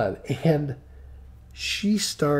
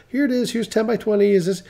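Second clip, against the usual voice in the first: higher-pitched, eager-to-finish patter; hurried showing; 7 semitones higher, 4 dB louder, faster than usual. Retold or imitated. Showing the unit to crying customer, mildly rushed. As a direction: push through it, pitched up, wanting it over. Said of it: "I'm just trying to rush through this".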